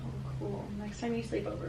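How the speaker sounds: ordinary talk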